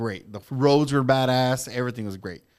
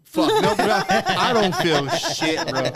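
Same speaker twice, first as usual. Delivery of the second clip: laughing denial; 11 semitones higher, 6 dB louder, slower than usual. Real, not acted. Laughing at friend's joke.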